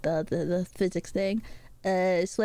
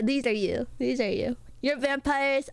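ordinary talk

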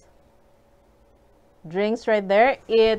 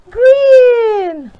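much higher and louder cry; excited shout; 12 semitones higher, 13 dB louder than usual. Real, not acted. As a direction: belt it out with joy. Loud shout of the food color.

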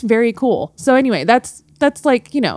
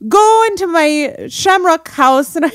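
higher, amateur Irish accent; 4 semitones higher than usual. Shown as a demonstration. Guest attempts Irish on request, hammy Irish accent. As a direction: have a clumsy go at Irish. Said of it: "do a little bit of an Irish".

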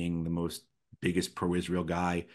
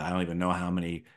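ordinary talk

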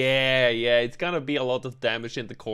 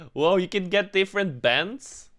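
ordinary talk, nothing marked

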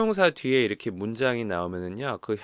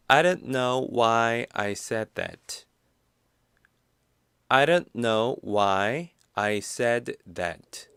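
slow model sentence; much slower than usual. Shown as a demonstration. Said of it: "slow teaching read".

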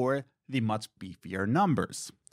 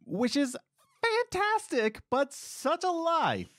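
very high sarcastic "fantastic"; 13 semitones higher, slower than usual. Acted. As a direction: sing it out with mock delight. Sarcastic praise.

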